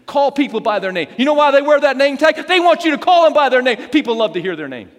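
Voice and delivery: loud, high, insistent exhortation; emphatic preaching. Real, not acted. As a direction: drive the point home to the crowd. Preaching at full force.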